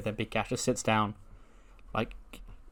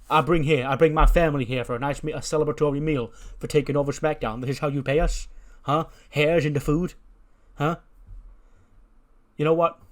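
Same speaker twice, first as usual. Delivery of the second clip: raised-pitch acted outrage at a waiter; indignant mob boss; 3 semitones higher, 5 dB louder than usual. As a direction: scold the waiter like an offended don. Boss scolds waiter over hair.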